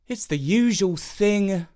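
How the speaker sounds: put-on high, slow and weary child's voice; a little boy who's seen it all before; resigned boy. A narrator's reading.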